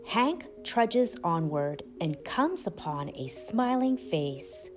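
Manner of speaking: usual speaking voice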